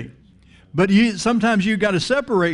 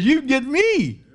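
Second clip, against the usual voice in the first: high, drawn-out preacher's God voice; comic high-pitched God; playful God; 9 semitones higher than usual. Acted. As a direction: play God as the big prize, pitched way up. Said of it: "God says".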